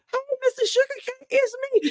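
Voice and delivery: high, quick, sheepish friend voice; girlfriend's reply. Retold or imitated. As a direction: chirp it up high and fast like a shy guest. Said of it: "my girlfriend was like".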